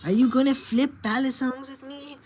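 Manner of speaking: high, lilting coo to a cat; playful pet talk. Real, not acted. Owner asks kitty to flip along, playful high pet talk.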